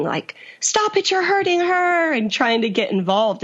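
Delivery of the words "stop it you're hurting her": very high, strained mock scream; retold scream. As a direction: imitate a panicked scream without the full volume. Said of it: "And so screaming".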